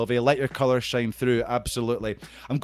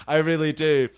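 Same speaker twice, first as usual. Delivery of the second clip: ordinary talk, nothing marked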